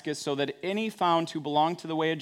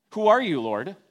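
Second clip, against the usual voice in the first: startled question; 4 semitones higher, 5 dB louder than usual. Acted. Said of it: "That's the kind of answer that Saul gives".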